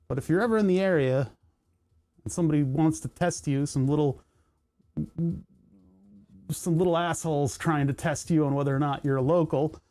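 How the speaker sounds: ordinary talk, nothing marked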